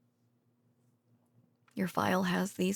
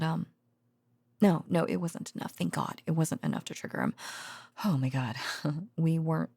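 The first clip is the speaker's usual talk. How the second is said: ordinary talk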